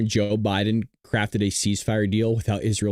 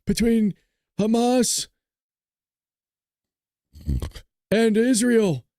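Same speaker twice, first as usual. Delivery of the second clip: high, halting mock-presidential announcement; Joe Biden impression; 9 semitones higher, slower than usual. Streamer retelling the president's ceasefire announcement, high mocking impression. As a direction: announce it in a comic politician voice, pausing before each name. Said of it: "he comes out and he goes".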